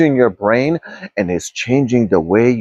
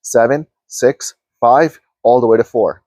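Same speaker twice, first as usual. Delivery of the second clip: guided meditation. Acted. Coach guiding viewers inward, in a calm meditation voice. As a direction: guide listeners gently through each energy center.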